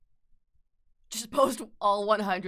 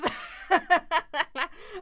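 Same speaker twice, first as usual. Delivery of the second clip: loud, higher-pitched burst of laughter; 8 semitones higher, 5 dB louder than usual. Real, not acted. Streamer teasing a viewer, bursting into laughter. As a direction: crack up at your own joke.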